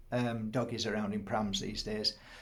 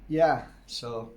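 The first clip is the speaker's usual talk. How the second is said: normal talking voice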